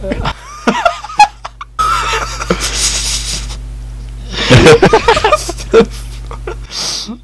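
long squeaky breathless laugh; high wheezing laugh. Real, not acted. Friend laughing at a meme quote, high wheeze.

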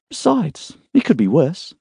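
a hint of a groggy young woman just waking; young woman's voice. A narrator's reading. Emma voiced, higher.

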